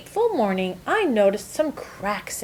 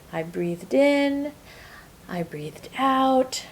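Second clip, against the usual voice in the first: calm; 3 semitones higher, 5 dB quieter, slower than usual. Acted. Calm breathing moment on road.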